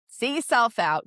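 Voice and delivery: now say it out loud and firm. Shown as a demonstration. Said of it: "repeated after whisper".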